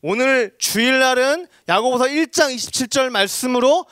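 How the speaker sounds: high, loud pulpit exhortation; preaching challenge. Retold or imitated. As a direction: voice the pastor's challenge with rising force. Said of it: "이렇게 도전하기로 결정한 거예요".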